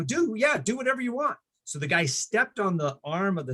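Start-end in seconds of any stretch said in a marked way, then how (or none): 0.0-1.4 carefree younger self. Retold or imitated. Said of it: "I go".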